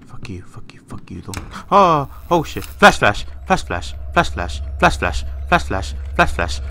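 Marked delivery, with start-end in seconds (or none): 0.1-1.4 whispered, pleading under the breath; frightened whispered curses; scared whisper. Real, not acted. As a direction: whisper it so nothing hears you. Dreading the room ahead.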